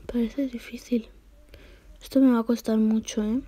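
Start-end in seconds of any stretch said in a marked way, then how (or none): 0.1-1.1 soft unvoiced whisper. Real, not acted. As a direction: whisper it to yourself. Quiet aside.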